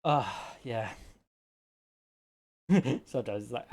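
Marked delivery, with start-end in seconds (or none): none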